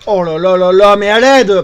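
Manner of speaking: loud panicked cry for help; panicked shout. Real, not acted. Overwhelmed by enemies, yelling.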